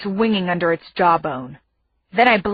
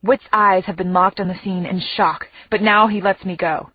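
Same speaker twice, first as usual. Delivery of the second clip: ordinary delivery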